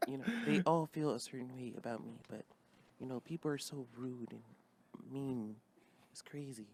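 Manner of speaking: [name] voice. Pretend.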